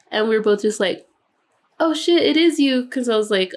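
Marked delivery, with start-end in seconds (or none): 1.8-2.9 high, louder gasp of recognition; like spotting someone you never expected to see; surprised recognition. Retold or imitated. Two women in a bar recognize each other, surprised high gasp.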